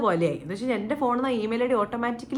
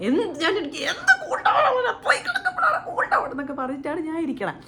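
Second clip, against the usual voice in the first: exasperated retort; 7 semitones higher than usual. Retold or imitated. Answering mum who says cool down, high exasperated laughing protest. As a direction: scoff at being told to cool down.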